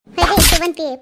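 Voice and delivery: angry yell. Acted. Shila yells during hair-pulling fight.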